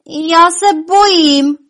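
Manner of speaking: high, loud, slow wail of fear; crying out. A narrator's reading. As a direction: cry out that you're scared, stretching it. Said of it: "vykřikla".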